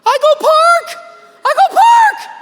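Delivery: imitating a toddler's wailing shout, very high and loud; mock tantrum howl from a toddler in a car seat; toddler bawling. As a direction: wail it like a two-year-old losing his mind. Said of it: "bawling his eyes out".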